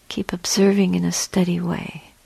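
ordinary delivery

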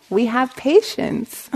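normal talking voice

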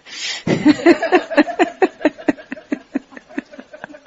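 long, high laughing fit; hearty laugh. Real, not acted. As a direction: laugh out loud, trailing into breath. Amused at outdoing her dad.

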